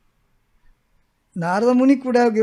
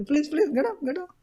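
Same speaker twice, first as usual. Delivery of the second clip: gentle pleading; 6 semitones higher, 8 dB quieter than usual. Acted. Narada gently urging dead child.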